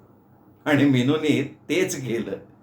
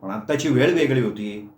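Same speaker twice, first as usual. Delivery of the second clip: plain reading voice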